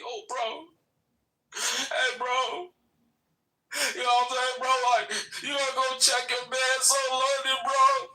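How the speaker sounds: high whiny crybaby imitation; mock crying voice. Mocking the rival crying to him, high and whiny. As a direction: whine it like he's about to cry. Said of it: "You cried to me".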